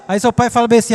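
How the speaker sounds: ordinary delivery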